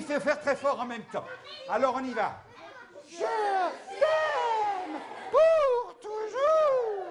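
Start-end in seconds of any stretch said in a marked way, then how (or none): 3.1-7.0 much higher, slow and sung out; the women sing it high and slow; high sweet voice. Shown as a demonstration. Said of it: "avec une jolie voix suave".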